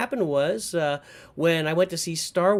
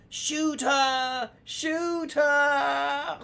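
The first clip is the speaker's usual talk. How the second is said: much higher, kid's mock shout from behind a corner; dad imitating his son's high mock shout; kid acting a movie; 10 semitones higher, much slower than usual. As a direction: play a little boy acting out the movie scene. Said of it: "you just hear him go".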